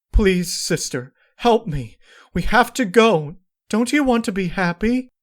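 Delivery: very high, loud, begging cry; desperate pleading. Acted. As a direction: beg her frantically.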